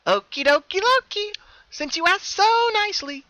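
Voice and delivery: very high, loud sing-song; bouncy and pleased; playful happy Pinkie. Acted. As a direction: twin Pinkie agreeing to show herself, playful. Hidden pony agrees playfully.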